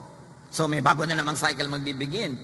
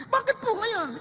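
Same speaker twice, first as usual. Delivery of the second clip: much higher, plaintive mock mourner asking why; grieving churchgoer. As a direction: play the bewildered mourner, high and pleading. Pastor voices grieving churchgoer.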